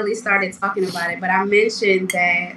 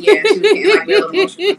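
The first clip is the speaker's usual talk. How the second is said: laughing; 9 semitones higher, 4 dB louder, much faster than usual. Real, not acted. Laughing while recalling.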